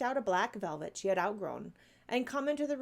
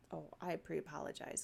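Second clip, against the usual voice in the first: usual speaking voice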